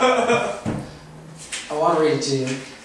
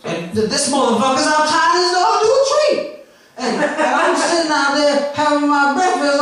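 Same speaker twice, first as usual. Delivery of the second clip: impression of rambling comic, higher; 9 semitones higher than usual. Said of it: "I'm going to do his set for you right now, verbatim".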